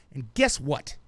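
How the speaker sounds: usual speaking voice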